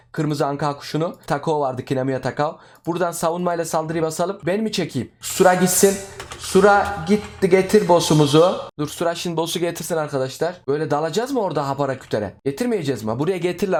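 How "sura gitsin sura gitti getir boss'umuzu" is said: calling out to teammate. Real, not acted.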